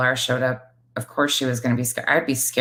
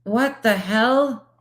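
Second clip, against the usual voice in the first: high, repeated stunned whats; shocked disbelief; 7 semitones higher than usual. Real, not acted. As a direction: react in utter disbelief.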